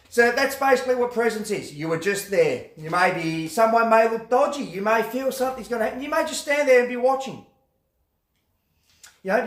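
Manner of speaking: ordinary talk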